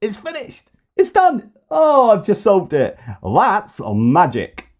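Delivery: high, silly magician gibberish; hammed-up, squeaky hocus-pocus voice; mocking Trump impression. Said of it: "how are you going to solve it?".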